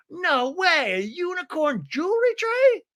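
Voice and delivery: very high, thrilled disbelief; Mary Cosby. Retold or imitated. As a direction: squeak it as an astonished question. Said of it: "She's so engaged".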